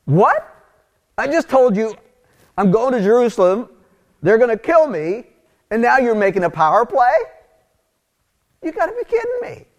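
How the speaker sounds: shrill indignant rant that cracks up high at the end; exasperated outburst. Pretend. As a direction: blow up at them like you can't believe it. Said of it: "what I probably would have done was something like".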